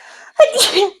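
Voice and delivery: sudden loud sneeze; a real sneeze, high and sharp; sneezing. Real, not acted. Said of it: "Sorry, I have to sneeze".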